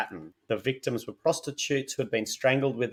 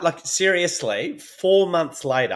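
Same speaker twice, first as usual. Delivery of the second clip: high, loud incredulous emphasis; emphatic disbelief; 7 semitones higher, 4 dB louder than usual. Real, not acted. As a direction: really sell the disbelief. Emphatic outrage at case.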